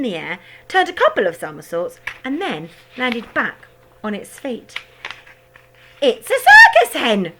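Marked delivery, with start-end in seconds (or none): 6.0-7.3 high, loud, slow and laughing; shouting grandma. A narrator's reading. Grandma shouting from the rooftop, laughing. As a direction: cackle it out in delight. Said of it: "Grandma shouted from the rooftop".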